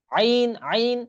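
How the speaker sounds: Arabic ain demo; throaty ain. Shown as a demonstration. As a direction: squeeze আইন from the middle of the throat. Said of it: "দেখেন অনেক ভিতর".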